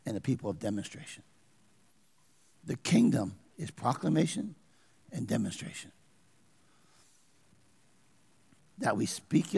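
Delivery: drops to a low, soft hush; hushed earnest preaching; quiet intensity. Acted. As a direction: lean in and say it softly.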